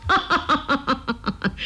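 long theatrical ha-ha-ha; hero's open, rolling laugh; hearty staged laugh. A narrator's reading. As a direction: laugh out grandly with head thrown back. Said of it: "仰天哈哈大笑".

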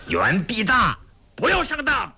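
urgent high-pitched cry of warning; shouted warning. A narrator's reading. Captive warns Yuan not to be tricked, shouting urgently. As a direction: cry out sharply to stop him being fooled. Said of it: "大喝一声".

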